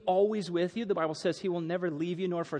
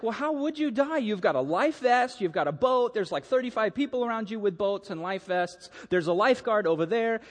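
higher, insistent mock exasperation; incredulous father; 5 semitones higher, 3 dB louder than usual. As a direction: pile up the reasons like a baffled dad. Father disputes son's fear of drowning.

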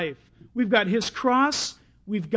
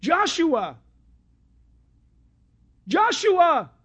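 high, loud call of a name; 7 semitones higher, 6 dB louder, much slower than usual. Acted. Blind beggar calling Jesus by his Hebrew name, calling out. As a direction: cry the name out across a crowd. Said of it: "What would he have said in Hebrew".

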